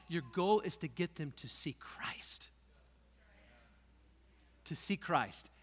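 soft whispered emphasis; quiet breathy stress. Acted. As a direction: whisper the key point. Sermon point whispered.

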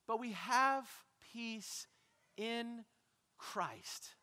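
soft, spaced-out words with pauses; hushed reassurance. Real, not acted. Preacher offers peace after the cries for help, hushed. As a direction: let it settle gently, word by word. Calm answer after the shouting.

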